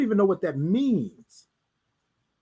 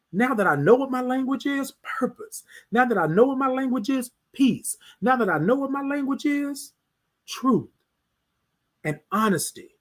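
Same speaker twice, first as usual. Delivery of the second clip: preaching refrain; 6 semitones higher than usual. Acted.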